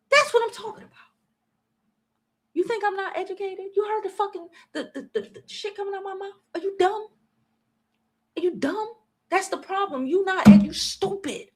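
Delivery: mock them from up high; high mocking taunt. Real, not acted.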